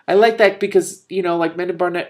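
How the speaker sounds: high, loud eager aside; excited comment. Real, not acted. Reader breaks off to praise the scene, excited. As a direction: gush about the part you liked. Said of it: "reader commentary".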